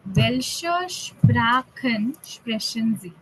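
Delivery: "welche Sprachen sprechen Sie?" said very slowly, word by word; slow model question. Shown as a demonstration. Teacher modelling the question for students, very slow. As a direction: spell the question out slowly for the class. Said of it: "if you remember the question".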